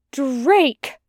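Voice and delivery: appalled outburst, drawn up high; apparent horror. A narrator's reading. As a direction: exclaim the name in dismay. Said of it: "he said in apparent horror".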